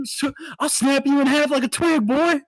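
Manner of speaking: hammed-up aggressive heckling; imitated drunk yelling. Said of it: "he just starts yelling".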